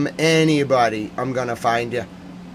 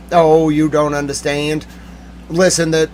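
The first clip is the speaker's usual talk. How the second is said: normal talking voice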